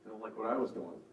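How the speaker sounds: ordinary delivery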